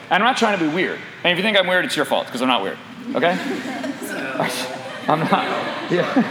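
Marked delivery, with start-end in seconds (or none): 3.4-5.0 breathy low chuckle; soft laugh. Real, not acted. Preacher laughing at his own joke, soft chuckle. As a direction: laugh quietly at yourself.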